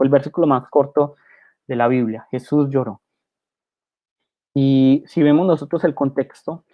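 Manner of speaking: ordinary talk, nothing marked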